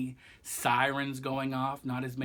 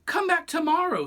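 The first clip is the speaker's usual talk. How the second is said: high goose voice; 7 dB louder than usual. Acted. Goose dismissing Stork at door.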